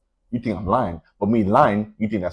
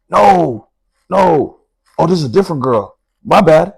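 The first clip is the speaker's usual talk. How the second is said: higher, louder, panicky take-back; flustered backpedal; 5 semitones higher, 4 dB louder than usual. Retold or imitated. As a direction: quickly take it back, embarrassed. Cousin backpedalling, retold.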